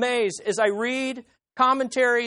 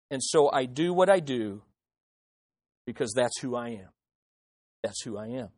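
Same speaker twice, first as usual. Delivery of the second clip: much lower and softer, grave; hushed solemn voice; 10 semitones lower, 6 dB quieter than usual. Acted. God declaring his sovereign wrath to Moses, low hushed solemnity. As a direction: drop to a hush for God's wrath.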